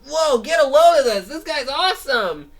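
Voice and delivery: very high, loud gasp of awe; thrilled cartoon onlooker; amazed shout. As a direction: shout in disbelief at the suit. Crowd amazed in Brickley.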